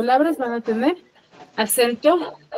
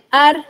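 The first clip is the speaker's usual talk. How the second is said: "ar" higher and louder; stressed syllable; 5 semitones higher, 7 dB louder than usual. Shown as a demonstration. Teacher isolates the tonic syllable, "ar" said forcefully. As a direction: hit "ar" hard as the strong syllable. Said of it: "La mayor fuerza de".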